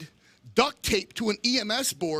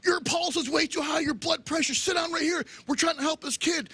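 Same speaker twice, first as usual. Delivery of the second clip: high-pitched imitation of an urgent nurse; 9 semitones higher than usual. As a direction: raise the pitch like a nurse giving urgent orders. Retold nurse urgently ordering him.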